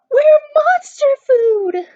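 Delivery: high, loud, slow terrified shout; scream. Acted. Murphy sure the monster is coming, screaming. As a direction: wail it at the top of your voice. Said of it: "Murphy screamed".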